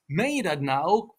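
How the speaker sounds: slow Dutch question with rising end; native-like Dutch. Retold or imitated. Repeating a phrase learned from Dutch people, native Dutch intonation. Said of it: "hearing people saying it in that way".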